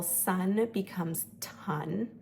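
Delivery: ton said with a hard t for the s; stopping demo. Shown as a demonstration. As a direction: stop the s in ton with a t. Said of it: "So for example".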